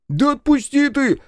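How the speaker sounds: high, loud, drawn-out protest; slow high-pitched indignant cry; yelled protest. A narrator's reading. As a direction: yell to be let go. Partner is grabbed and resists.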